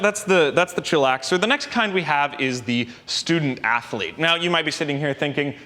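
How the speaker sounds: normal talking voice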